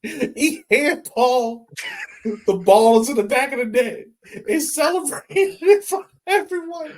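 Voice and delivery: giggling through the story; laughing. Real, not acted. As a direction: tell it while cracking up. Recalling the handball goal.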